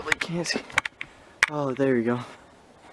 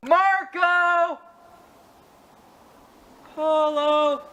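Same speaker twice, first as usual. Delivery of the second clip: high calling yell; 10 semitones higher, much slower than usual. Pretend. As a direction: call it across the jungle. Calling out into the jungle.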